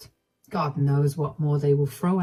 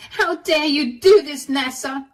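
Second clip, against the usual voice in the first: shouting; 7 semitones higher than usual. Acted. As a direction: yell it in her face, outraged. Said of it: "started shouting in my face".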